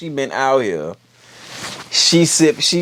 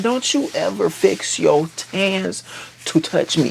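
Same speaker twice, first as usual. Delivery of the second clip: slowed, deliberate mock menace; 6 semitones higher than usual. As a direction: spell out each word as a warning. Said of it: "he said".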